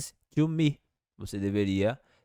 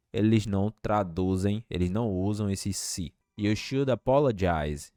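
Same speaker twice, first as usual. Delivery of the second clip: ordinary talk, nothing marked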